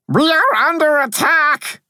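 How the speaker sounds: very high, loud little-creature cry; high-pitched battle alert; alarmed cry. A narrator's reading. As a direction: sound the alarm in a piping voice. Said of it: "I cried".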